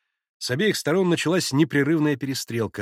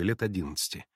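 usual speaking voice

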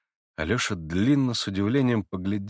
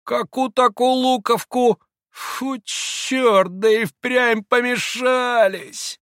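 higher, louder sneering man; mocking Rakitin; 14 semitones higher, 4 dB louder, much slower than usual. A narrator's reading. Rakitin taunts Grushenka, sneering and loud. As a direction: tease her with contempt.

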